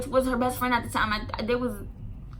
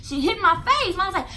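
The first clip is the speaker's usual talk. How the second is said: ordinary talk, nothing marked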